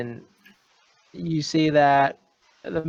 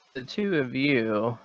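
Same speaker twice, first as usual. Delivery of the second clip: ordinary talk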